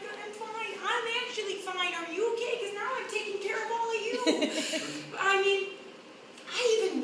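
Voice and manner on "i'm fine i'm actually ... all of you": high-pitched insistence on being okay; over-reassure everyone in a high voice; strained reassurance. Acted. Patient taking care of upset relatives, strained reassurance. Acted reassurance to distressed family.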